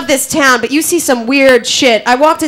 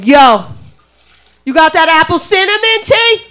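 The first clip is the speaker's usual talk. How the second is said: mock thug confrontation; 4 semitones higher than usual. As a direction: play a thug getting in a rival's face. Acted thug confronting rival face to face.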